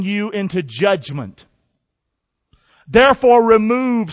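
slow, measured, declaiming King James verse; solemn scripture reading. Acted. Preacher reads Ecclesiastes to the congregation with solemn weight. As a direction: read the Bible slowly and grandly.

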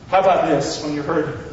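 ordinary talk, nothing marked

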